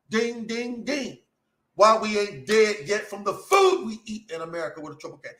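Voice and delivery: high-pitched, exultant preacher with drawn-out key words; full-force preaching. Real, not acted. Preacher promising deliverance to Israel, ecstatic high-pitched preaching. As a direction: preach it high, in bursts of loudness.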